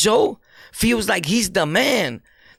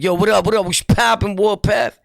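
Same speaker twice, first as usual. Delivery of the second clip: louder, hyped-up mock street greeting; street slang talk; 5 semitones higher, 4 dB louder than usual. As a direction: mimic a loud street dude hollering greetings. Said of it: "He's not talking like".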